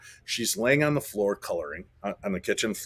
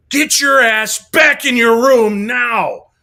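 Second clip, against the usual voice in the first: full-volume, high, slow parental shout; angry dad yell; 11 semitones higher, 13 dB louder, slower than usual. Retold or imitated. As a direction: roar a drawn-out order at your kid. Father yelling at young son, retold.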